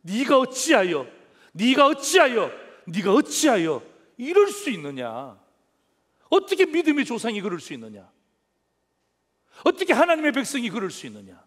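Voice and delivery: loud, accusing rebuke as Pharaoh; harsh rebuke. Acted. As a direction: point the finger and scold hard. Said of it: "혹독하게 책망하고 있습니다". (바로가 아브라함을 손가락질하며 혹독하게 책망)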